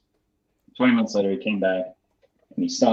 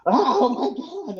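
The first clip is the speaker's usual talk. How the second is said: mock freak-out. Recordist seeing the melted gear, hammed-up freaking out. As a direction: imitate a colleague's shocked outcry. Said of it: "like, freaking out".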